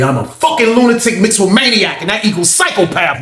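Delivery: high-pitched defiant tirade, retold; defiant boast. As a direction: declare yourself crazy, pitch climbing. Retold tirade at Miss Ray.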